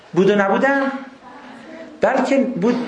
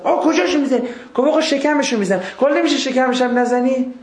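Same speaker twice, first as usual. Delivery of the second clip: mock pained customer; 6 semitones higher than usual. Retold tattoo customer and artist.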